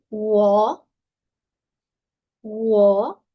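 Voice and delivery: Wo gliding from an U sound, drawn out. Shown as a demonstration. Teacher models W for students, Wo starting like U. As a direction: start Wo on a rounded U and glide. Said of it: "la W es como una U y una U".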